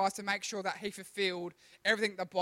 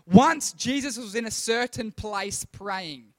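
slow, loud, solemn reading of the verse; scripture reading; 10 dB louder, slower than usual. Shown as a demonstration. Youth pastor reading the verse aloud to the group, slow and solemn. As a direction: read it out slow and weighty. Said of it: "Are we ready to read this?".

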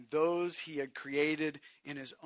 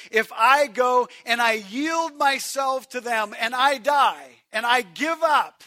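loud; 7 semitones higher, 13 dB louder than usual. Acted. Voicing Jesus, shouted.

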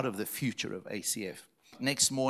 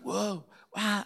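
put-on much higher "Whoa, wow" in a girl's voice; mock amazed gasp; 10 semitones higher than usual. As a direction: imitate her wonder with a high gasp. Imitating girl overwhelmed.

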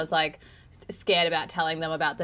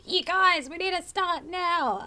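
higher, insistent party-voice demand; mock pushy demand; 11 semitones higher, slower than usual. Guest retelling urging hosts at her party, high mock insistence. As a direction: press them to start, in a high put-on voice. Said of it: "I was like".

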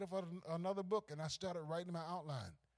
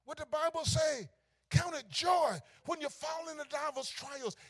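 loud, high scripture cry; ringing pulpit quote; preaching shout; 10 semitones higher, 7 dB louder than usual. Real, not acted. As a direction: proclaim the verse to the whole church.

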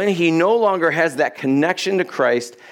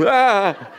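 high mock yelp of fright with a laugh; short laughing startled cry; 5 semitones higher than usual. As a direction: re-enact being grabbed from behind. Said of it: "felt like someone was pulling me".

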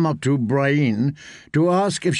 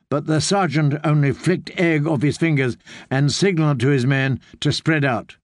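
ordinary delivery

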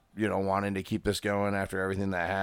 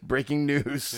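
higher-pitched mock newsflash; mock news flash; 5 semitones higher, slower than usual. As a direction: announce a joke headline, pitched up. Mock news flash after a laugh.

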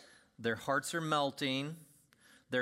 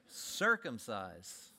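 circumcise drawn out even slower and lower; slower deadpan repeat; 6 semitones lower, much slower than usual. Acted. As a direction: repeat it slower, letting it sink in. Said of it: "What do we do?".

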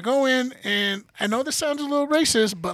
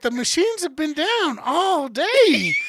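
put-on high, slow, sing-song Mexican accent; imitated Mexican accent; 8 semitones higher, 4 dB louder, much slower than usual. Receptionist telling a patient of broken machines, hammed-up Mexican accent. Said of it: "Love this accent".

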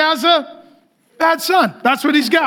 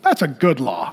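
parent's approval; 11 semitones lower, 3 dB quieter than usual. Retold or imitated. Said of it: "When you're a parent, you're like".

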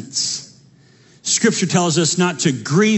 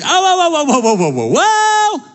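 높게 끌며 과장된 놀람; mock alarm; 9 semitones higher than usual.

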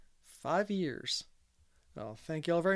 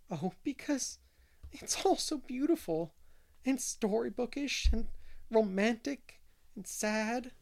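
teary; 7 semitones higher than usual. A narrator's reading. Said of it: "winking the tears out of her eyes".

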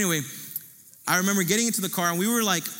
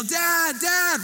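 high mock yell calling Dad; retold yell for dad; 12 semitones higher, 4 dB louder than usual. Four-year-old yells for his dad from the rolling car, high mock yell. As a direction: call out for Dad, pitched high and a bit louder. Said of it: "I'm yelling as loud as I can".

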